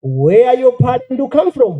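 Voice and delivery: put-on high Japanese-accented questioning; mock Japanese child. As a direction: imitate a curious Japanese kid.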